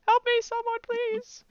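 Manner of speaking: very high, pretend cry for help; shouted plea. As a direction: play a fleeing character calling desperately for rescue. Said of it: "but keeps shouting".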